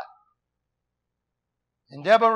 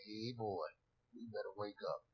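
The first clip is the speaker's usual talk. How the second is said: much lower and softer, a quiet stern warning; 10 semitones lower, 21 dB quieter than usual. Acted. Preacher giving a sleepy listener a low, stern wake-up call.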